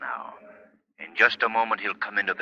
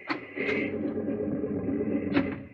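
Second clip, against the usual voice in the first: eerie laugh; 6 dB quieter than usual. Acted. The Shadow reveals himself.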